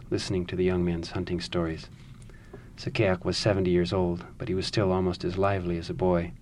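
calm, measured storytelling read; story narration. A narrator's reading. Reads the novel passage aloud.

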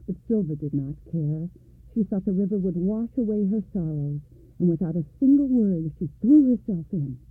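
ordinary delivery, nothing marked

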